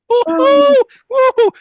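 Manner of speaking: very high, loud falsetto whoop; giddy cartoon hunter yelp of joy; jubilant whoop. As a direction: let out a wild celebratory whoop twice. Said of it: "that's me doing the Elmer Fudd and playing".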